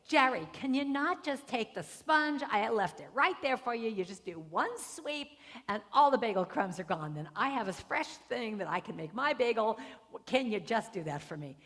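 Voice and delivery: loud mock nagging, hammering key words; hammed-up irritated kitchen rant; pissy wife. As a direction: imitate an exasperated spouse scolding. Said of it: "she would get really pissy".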